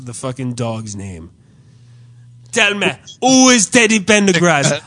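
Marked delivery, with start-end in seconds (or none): 2.5-4.8 put-on loud, higher Caribbean-accented demand; Caribbean clerk. Clerk demanding who the other addressee is, loud Caribbean imitation. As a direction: imitate a gravely concerned Caribbean clerk, loudly. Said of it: "like, Caribbean dude who's kinda".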